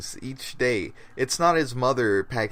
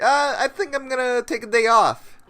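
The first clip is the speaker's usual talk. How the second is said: higher silly voice for Jake; high mock voice; 12 semitones higher, 3 dB louder than usual. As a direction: play it up high and goofy. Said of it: "He's like".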